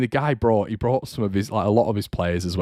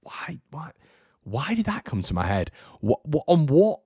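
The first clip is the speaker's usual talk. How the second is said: high incredulous asking himself; bewildered self-question; 7 semitones higher than usual. Retold or imitated. Fan replaying his embarrassment afterwards. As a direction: puzzle over your own words. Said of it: "you're like".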